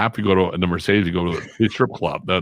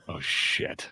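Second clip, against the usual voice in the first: dismayed aside; 8 dB quieter than usual. Retold or imitated. Realizing he cannot pay.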